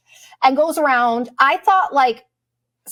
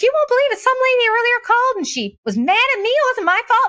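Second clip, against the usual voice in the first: much higher, chatty gossiping clerk voice; high mock clerk voice; check-in lady; 12 semitones higher, faster than usual. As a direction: mimic the airline clerk spilling the gossip.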